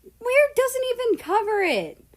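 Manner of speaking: high, loud scoff; snorting. A narrator's reading. America snorts at Russia, high scoffing. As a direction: laugh it off at him. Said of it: "America snorted".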